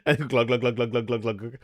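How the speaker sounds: a playful imitated glug sound; pouring glugs. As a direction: mimic liquor pouring freely.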